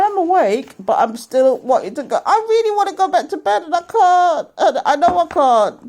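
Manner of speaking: hammed-up sleepy whimper; whiny mock sob. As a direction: fake cry about bedtime. Whining about wanting to sleep.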